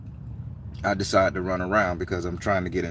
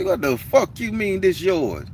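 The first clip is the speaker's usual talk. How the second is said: slow, higher, retold angry retort; 10 semitones higher than usual. The Baron telling off the stranger about the bench, angry. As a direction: spit it back at him slowly. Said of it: "I say".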